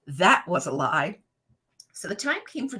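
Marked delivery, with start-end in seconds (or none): 0.1-1.2 Maury Povich verdict. Retold or imitated. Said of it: "Maury Povich says".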